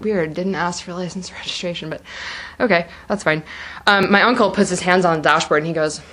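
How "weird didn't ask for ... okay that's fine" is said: hushed inner thought. Retold or imitated. Thinking to herself during the traffic stop, hushed. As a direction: think it quietly to yourself. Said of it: "I thought in my head".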